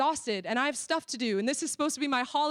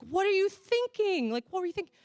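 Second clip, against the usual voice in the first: mock angry; 8 semitones higher than usual. Mentor scolding the girl over the phone, retold anger. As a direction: stretch it out in high-pitched disbelief. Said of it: "getting angry 'cause I'm like".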